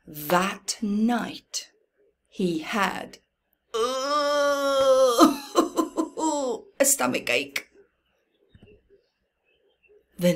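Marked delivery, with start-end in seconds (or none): 3.7-6.6 a short real laugh at the punchline; amused laugh. Real, not acted. Reading to a child, laughing at the tummy ache. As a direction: chuckle warmly mid-story.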